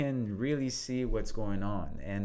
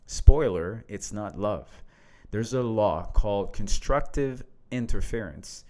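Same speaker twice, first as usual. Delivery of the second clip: reading a post aloud; 5 dB louder than usual. Acted. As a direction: lean into each line as you read it out. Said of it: "I will read this".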